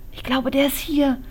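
usual speaking voice

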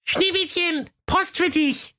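very high, squeaky call together; dwarfs calling in unison. Acted. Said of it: "riefen die sieben ... wie aus einem Munde".

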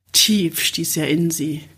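ordinary delivery, nothing marked

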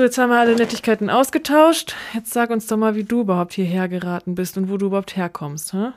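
ordinary talk, nothing marked

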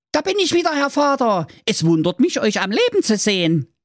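put-on very high young son voice; youngest son voice. Youngest son greeting father, very high voice.

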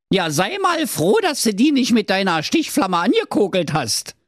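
high, daft Berlin prince voice; silly prince. Acted. Prince diagnoses the dragon's heartburn, high daft voice. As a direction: play the dopey prince, high.

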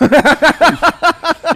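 squealing laugh at the number; high burst of laughter. Real, not acted. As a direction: laugh out loud in shock. Reaction to four-figure sum.

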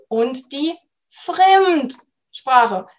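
und die Fremdsprache, very slowly, leaning hard on fremd; stress on fremd. Shown as a demonstration. Language coach to learners, stressing fremd in Fremdsprache. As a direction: drag out and weight the fremd in Fremdsprache. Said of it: "Betonung liegt hier auf dem Wort fremd".

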